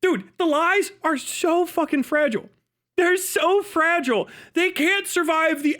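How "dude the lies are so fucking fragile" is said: usual speaking voice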